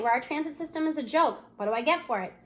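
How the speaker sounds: ordinary delivery, nothing marked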